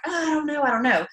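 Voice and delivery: high, hesitant doubting voice; skeptical customer. Retold or imitated. A doubtful prospect hesitating, lightly voiced skeptic. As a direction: hedge like someone who's been burned before. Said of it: "people that are like".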